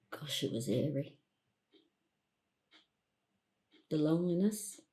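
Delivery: soft, low, with long pauses; subdued recollection. Acted. Woman alone recalling eerie lockdown, hushed and low. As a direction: let it sink quietly as the memory returns.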